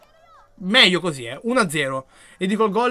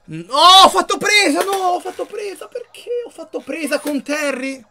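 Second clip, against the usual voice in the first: alarmed surprise; 12 semitones higher, 6 dB louder than usual. Real, not acted. To himself as the keeper catches, alarmed disbelief. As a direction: panic as the wrong move fires. Keeper chose the wrong move.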